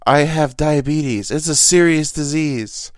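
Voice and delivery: loud, slow mock yell; hammed-up fake yell. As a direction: yell it in jest at a friend. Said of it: "fake yelling at each other".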